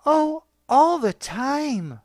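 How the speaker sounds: high, slow sarcastic woman's voice; mocking wife. A narrator's reading. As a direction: drag it out mockingly. Wife's mocking reply on phone.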